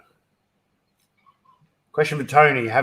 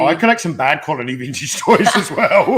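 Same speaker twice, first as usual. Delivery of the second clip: loud, amused protest breaking into laughter; laughing comeback; 3 semitones higher, 6 dB louder than usual. Real, not acted. Joking back at her praise of his toys, laughing. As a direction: laugh through the words.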